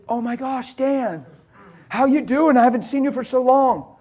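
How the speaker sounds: high, delighted mock hello; imitated surprised greeting. Man spotting long-missing friend at gas pump, imitated surprised greeting.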